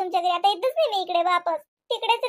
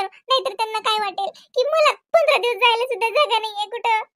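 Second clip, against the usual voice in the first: ordinary talk, nothing marked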